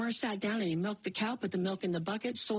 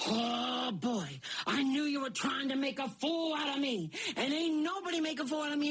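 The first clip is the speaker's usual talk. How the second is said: wailing giant; 5 semitones higher than usual. Acted. Giant crying at Jack that he was fooled, wailing. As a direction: bawl it like a tantrum. Giant angry nothing grew.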